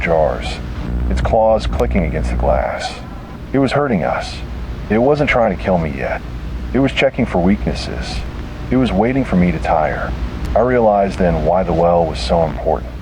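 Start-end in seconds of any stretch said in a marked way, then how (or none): none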